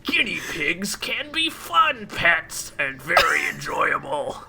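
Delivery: slow, eerie monster murmur; creepy Taken voice. Pretend. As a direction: drag it out like a ghoul. Mocking Taken whisper voice.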